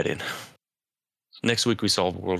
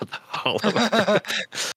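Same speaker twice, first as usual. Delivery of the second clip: drawn-out mock awe; 13 semitones higher, much slower than usual. Friends joking about conspiracies, mock-awed drawl. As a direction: stretch Hollow Earth out slow and high like a spooky reveal.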